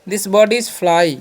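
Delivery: very slow, word by word; slow classroom dictation; slow example sentence. Shown as a demonstration. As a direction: spell it out slowly for the class. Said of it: "इसे बोल सकते हैं".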